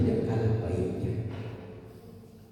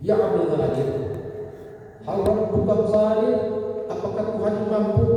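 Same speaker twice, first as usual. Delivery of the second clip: put-on urgent drunk man; 9 semitones higher than usual. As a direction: call out the question at full force. Drunk man asks third time.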